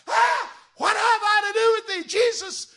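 high, loud shrieked demon cry. Retold or imitated. The demons cry out to Jesus, loud high shriek. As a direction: screech it like a possessed man. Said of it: "those demons says".

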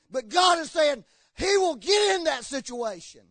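roaring pulpit delivery; full-force preaching. Acted. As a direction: preach it out loud to the whole room.

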